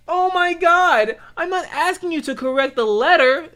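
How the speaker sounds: high, loud whiny mock exasperation; high whiny mock "oh my God"; exasperated scammer. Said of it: "And he's like".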